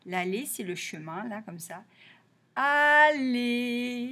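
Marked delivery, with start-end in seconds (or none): 2.5-4.1 ALLÉE stretched slowly, both syllables held long; drawn-out word. Shown as a demonstration. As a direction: say Allée slowly on a sustained, level tone. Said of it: "ça va être l'allée".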